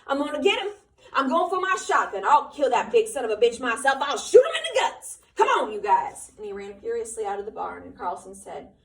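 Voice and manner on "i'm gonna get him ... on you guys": high, loud raging accusation; furious shout. Acted. Curly accusing Lenny over the body, furious. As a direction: scream out who did it. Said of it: "He worked himself into a fury".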